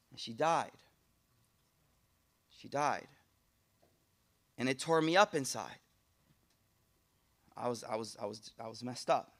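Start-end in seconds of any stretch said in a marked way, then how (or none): 0.1-3.1 dropping low and soft on died; a father's grief, hushed and heavy; grieving hush. Retold or imitated. Preacher retelling the daughter's death, hushed and grieving. 7.6-9.3 low, soft, halting repeat; broken hush. Retold or imitated. Preacher voicing the grieving father, broken and hushed.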